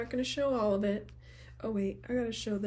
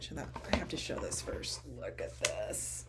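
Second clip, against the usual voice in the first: lower, softer near-whisper; hushed; 5 semitones lower, 7 dB quieter than usual. Real, not acted. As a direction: draw them in with a hush. Showing the box, hushed.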